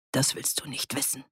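soft, hushed warning; quietly. A narrator's reading. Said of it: "sagte er leise".

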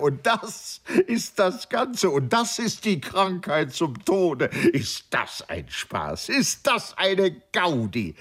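high, slowed-down incredulous glee; amused exclaiming. A narrator's reading. Nanda makes fun of his friend's deadly illness, high-pitched amused exclaiming. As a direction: revel in the joke, voice leaping high. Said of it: "in unendliches Gelächter aus".

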